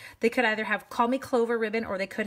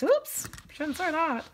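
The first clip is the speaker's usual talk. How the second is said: higher, slow mock-sheepish whoops; retold oops; 8 semitones higher, slower than usual. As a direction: play a little slip-of-the-tongue regret. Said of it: "it was kinda like".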